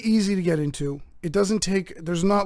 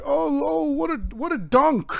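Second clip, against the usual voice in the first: mock amazement; 8 semitones higher than usual. Mocks an excited basketball watcher.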